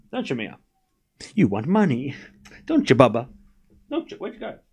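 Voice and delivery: soft cooing to a pet; pet talk. Real, not acted. Owner softly baby-talking to pet Mia. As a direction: coo at the pet gently.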